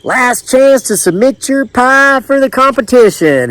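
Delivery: high, sustained announcing call; distant caller's yell. A narrator's reading. Pie contest caller summoning last entries, high yell. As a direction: call it out high across the tables. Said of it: "another caller yelled from a few tables away".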